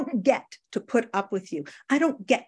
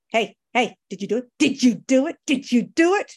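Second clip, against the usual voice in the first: pushy sponsor; 2 semitones higher, 5 dB louder than usual. Retold or imitated. Sponsor pressing her in story.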